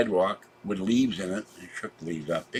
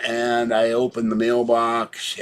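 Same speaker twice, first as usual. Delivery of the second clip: ordinary talk, nothing marked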